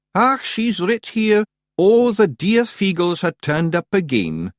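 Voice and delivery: high, slow Scots wee-man voice; gleeful Scots pictsie reading a letter; high Scottish pictsie. Acted. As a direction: play a tiny Scottish rogue reading slowly.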